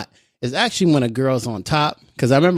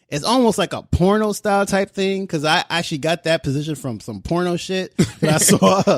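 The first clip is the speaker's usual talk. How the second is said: usual speaking voice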